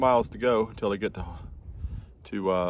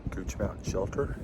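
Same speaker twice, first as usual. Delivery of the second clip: ordinary talk, nothing marked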